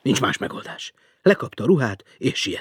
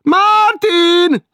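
much higher, loud shrill call; woman calling; 14 dB louder than usual. Acted. Woman below checking who is there, shrill call.

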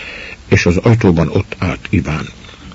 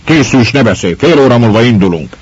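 higher, louder angry command; angry lord; 5 semitones higher, 5 dB louder than usual. Acted. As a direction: cut her off in anger. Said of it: "szemöldökei haragosan rándultak össze".

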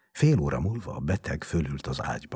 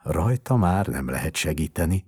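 usual speaking voice

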